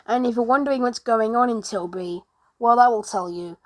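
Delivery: usual speaking voice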